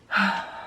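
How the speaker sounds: a quiet, shaky sigh, real; heavy sigh. Real, not acted. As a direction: sigh to steady herself before going on. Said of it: "this makes me super emotional".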